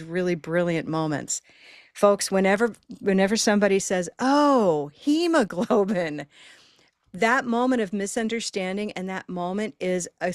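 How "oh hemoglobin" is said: high "oh", then hemoglobin slow with the stress on the first syllable; modeled correction. Shown as a demonstration. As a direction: model the listener's helpful correction. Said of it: "exaggerate the stress without even knowing".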